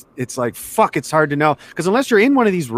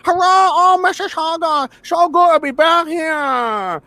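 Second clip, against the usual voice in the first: high, slow put-on Chinese accent for a comic; mock Chinese accent; 10 semitones higher, slower than usual. Mocking a comedian fresh from Shanghai, in a high mock Chinese accent. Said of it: "He's not even, like, American Chinese where he's got no accent".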